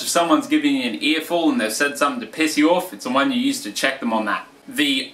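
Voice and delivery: ordinary talk, nothing marked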